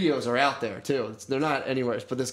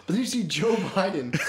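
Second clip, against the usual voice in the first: giggling through the words; can't stop laughing; 8 semitones higher than usual. Real, not acted. Friend cracking up telling the Biden story, laughing. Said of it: "this is really funny".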